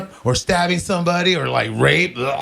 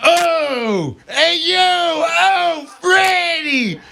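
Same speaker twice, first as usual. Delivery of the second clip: mobster pleading; 9 semitones higher, much slower than usual. Pretend. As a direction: yell it like a hammed-up East Coast tough guy. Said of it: "He's hooking up with them".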